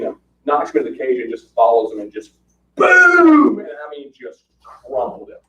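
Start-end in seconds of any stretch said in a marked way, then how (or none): 2.7-3.6 loud boom. Real, not acted. Telling friends how the punch landed, a big shouted boom. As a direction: shout boom much higher and louder.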